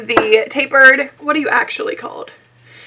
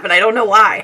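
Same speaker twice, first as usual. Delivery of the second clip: normal talking voice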